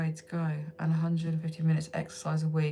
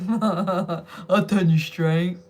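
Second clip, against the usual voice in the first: slow, drawn-out mock pickup line, louder; 4 dB louder, much slower than usual. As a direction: drag out a cheesy pickup line. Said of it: "a line I've heard a million times".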